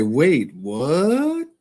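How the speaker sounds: high, rising mock-incredulous "Wait, what?"; a lightly done Fifty-four Keys catchphrase; imitating Fifty-four Keys. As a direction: quote his signature line, high and quizzical. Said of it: "Fifty-four Keys would say".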